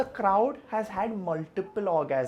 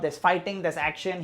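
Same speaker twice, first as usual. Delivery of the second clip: ordinary talk, nothing marked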